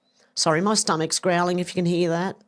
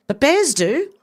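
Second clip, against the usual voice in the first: louder, higher emphatic point; 8 semitones higher, 4 dB louder than usual. Real, not acted. Arguing with chat that bears are worse, loud and emphatic. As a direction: punch it like a winning argument.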